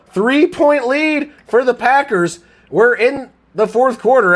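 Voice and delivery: high, loud elated play-by-play; thrilled celebrating. Real, not acted. Streamer celebrating the two-point conversion, high and loud. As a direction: yell with joy as the conversion lands.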